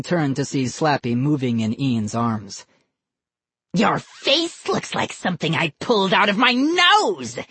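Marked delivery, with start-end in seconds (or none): 3.7-7.4 high, harsh dummy voice; crying out harshly. A narrator's reading. Dummy insults the boy, crying out harshly. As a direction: cry the insult out as a raspy puppet. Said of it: "Slappy cried".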